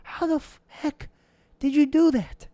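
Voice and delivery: incredulous, high pitched questions; amazed disbelief. Retold or imitated. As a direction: marvel at a friend's success. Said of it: "and I'm like".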